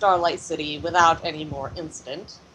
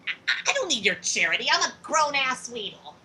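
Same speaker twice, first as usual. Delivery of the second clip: indignant little character, pitch jumping up; hint of a huffy child, louder at the end; lightly voiced proud youngster; 4 semitones higher, 4 dB louder than usual. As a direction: protest like a kid insisting on being grown-up. Game master voices proud young Weedle.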